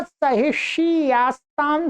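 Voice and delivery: ordinary talk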